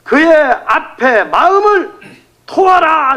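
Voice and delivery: preacher crying out the verse; impassioned scripture quote. Acted. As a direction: call it out to the people, rising. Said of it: "이렇게 했어요".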